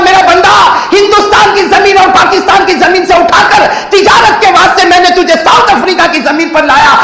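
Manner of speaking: loud, high booming reproach; high rebuking shout as God addressing his servant; God's voice. Retold or imitated. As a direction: thunder it down on the wayward servant. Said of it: "اور اللہ کہتا ہوگا".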